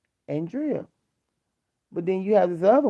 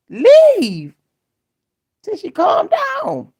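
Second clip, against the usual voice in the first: high, loud, dragged-out shout; high yell; 10 dB louder, much slower than usual. Retold or imitated. Daughter yelling at the boyfriend to leave, high shout. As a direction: holler it high and slow.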